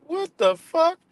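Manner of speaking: high, loud incredulous what; jaw-dropped outrage; shocked disbelief. Retold or imitated. Vlogger reacting to hearing the slur, high and stunned. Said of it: "I'm like".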